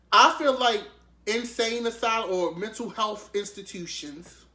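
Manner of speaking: ordinary talk, nothing marked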